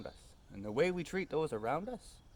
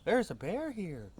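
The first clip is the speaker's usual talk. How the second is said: put-on high, louder child's voice; little girl; 9 semitones higher, 5 dB louder, slower than usual. Daughter telling the Germans about the bear, high child voice. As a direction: pipe up like an excited little girl. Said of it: "she goes up to them and says".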